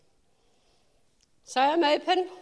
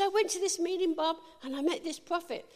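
excited retelling; 7 semitones higher than usual. Retold or imitated. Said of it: "I was so excited".

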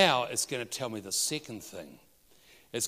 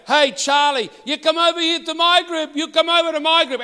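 loud shout across the room; yelling out; 16 dB louder than usual. Retold or imitated. Man yelling to his friend to join his group, shouting. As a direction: call the friend over at full volume. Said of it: "So he yells out".

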